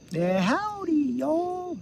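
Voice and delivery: high, hammed-up Texas cowboy greeting; Texas drawl. Joking that Doctor Phil sounds Texan, hammed-up Texas accent. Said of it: "Not that he's from Texas".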